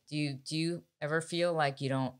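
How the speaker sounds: normal talking voice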